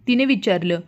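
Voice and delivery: usual speaking voice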